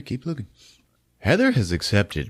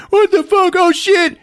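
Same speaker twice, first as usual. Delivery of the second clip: very high, louder shocked cry; mock panic; 6 dB louder than usual. Last gang member seeing the bodies, high panicked cry. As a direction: yelp in mock fright.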